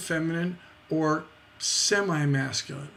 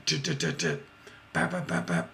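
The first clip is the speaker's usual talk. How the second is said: machine gun rhythm; 10 semitones lower, 4 dB quieter, faster than usual. Shown as a demonstration. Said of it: "like a machine gun".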